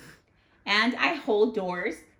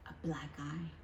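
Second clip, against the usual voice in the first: soft unvoiced whisper of the answer; hushed stage whisper; 6 semitones lower, 13 dB quieter than usual. Shown as a demonstration. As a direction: breathe it like a secret. Teacher prompts, answer whispered.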